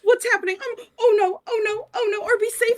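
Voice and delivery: high, loud frantic pleading; panicking mother under attack; panicked. Acted. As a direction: babble in terror as arrows fly. Liv panics in an ambush.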